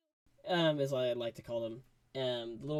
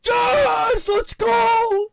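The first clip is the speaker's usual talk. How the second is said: loud, much higher shout; cheering; 16 dB louder, much slower than usual. Retold or imitated. Vlogger cheering Rocket's win, shouting. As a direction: yell with victory. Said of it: "I was like".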